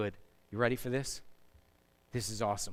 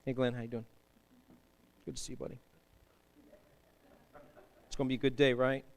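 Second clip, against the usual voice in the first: soft, automatic hey in passing; absent greeting; 7 semitones lower, 8 dB quieter, much faster than usual. Retold or imitated. Pastor mocking a distracted passing greeting, soft and automatic. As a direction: toss it off without really being there. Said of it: "We walk around with this thing".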